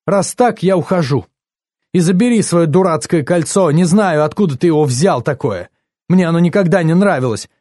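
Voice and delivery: high, loud parting shot; heated, scornful break-up tirade; indignant quarrel. A narrator's reading. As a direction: hurl it out as you walk out. Acted break-up quarrel, storming out.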